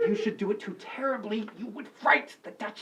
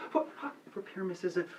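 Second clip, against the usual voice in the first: normal talking voice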